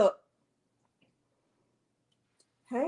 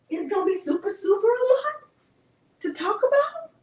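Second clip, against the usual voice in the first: excited; 9 semitones higher than usual. Real, not acted. Excited announcement to viewers.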